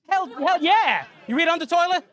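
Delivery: high, hammed-up enthusiastic yell; fired-up macho banter. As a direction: whoop it like a bro bonding. Acts fired-up manly podcast banter.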